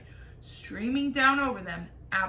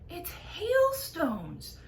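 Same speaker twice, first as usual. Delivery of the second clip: excited whisper; 8 semitones higher, much slower than usual. A narrator's reading. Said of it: "whispered James excitedly".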